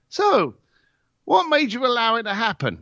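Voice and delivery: high, pointed mock-innocent question; needling. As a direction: lightly rub it in with a leading question. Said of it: "I'm gonna go for the final rub".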